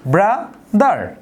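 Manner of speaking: ব্রাদার drawn out slowly in two beats bra-dar; slow syllables. Shown as a demonstration. Teacher slowly showing the class two beats in ব্রাদার. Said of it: "দুইবার উচ্চারণ করি".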